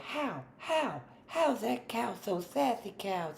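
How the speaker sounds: squeaky high sassy cow asking how. Retold or imitated. Player voicing the sassy cow, high and squeaky. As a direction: pitch way up, play the sassy cow. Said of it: "She say".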